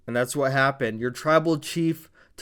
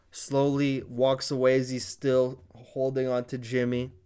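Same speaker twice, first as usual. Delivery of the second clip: ordinary delivery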